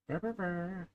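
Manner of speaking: high, playful rolled brr; silly sign-off trill. Real, not acted. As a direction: trill a quick goodbye purr. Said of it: "copying off other people, I go".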